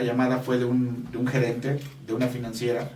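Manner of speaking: ordinary talk, nothing marked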